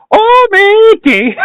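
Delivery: high-pitched mock Irish leprechaun. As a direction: squeal it like a cartoon leprechaun. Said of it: "you gotta be a leprechaun".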